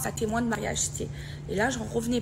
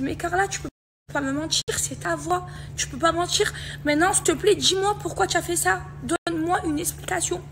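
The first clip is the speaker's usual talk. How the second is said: high, insistent demand building louder; retold pleading confrontation; 8 semitones higher, 4 dB louder than usual. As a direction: re-enact begging a friend to confess, pitched up. Confrontation rejouée avec la copine. (supplication indignée rejouée)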